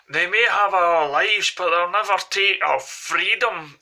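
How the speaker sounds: put-on loud, high rallying cry in a Glaswegian accent; hammed-up Glaswegian movie battle speech; William Wallace's Glaswegian accent. Quoting Braveheart to passengers, impassioned Glaswegian accent. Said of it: "the way he said it was, was Glaswegian".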